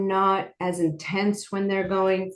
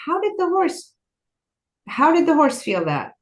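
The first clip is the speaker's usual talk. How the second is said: higher-pitched, marvelling question; amazed wondering; 9 semitones higher, 3 dB louder than usual. Real, not acted. Storyteller marvels at the horse sensing a woman's need, amazed. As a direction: ask it in genuine amazement, voice lifted.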